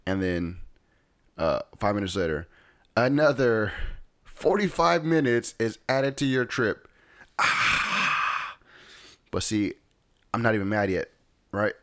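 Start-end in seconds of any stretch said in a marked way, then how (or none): 3.0-6.9 higher, louder mock navigation alert; GPS announcement. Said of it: "it says". 7.4-8.6 long, forceful unvoiced exhale; exasperated sigh. Reacting to another delay.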